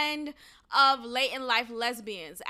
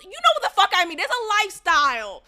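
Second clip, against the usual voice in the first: high, loud burst of insisting; exasperated insistence; 6 semitones higher, 7 dB louder, faster than usual. Real, not acted. Podcaster defending her word choice to listeners, exasperated. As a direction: push the point loudly, pitched up.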